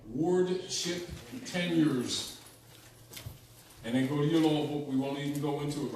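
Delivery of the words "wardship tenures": drawn out slowly for note-taking; slow dictation. Shown as a demonstration. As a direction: spell it out slowly so they can write. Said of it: "write that down".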